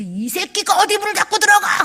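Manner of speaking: high furious yelling father; angry father. Retold or imitated. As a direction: bellow at the son who slammed the door. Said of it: "이러시면 안 된다는 거예요". (문 쾅 닫은 아들에게 고함치는 화난 아빠)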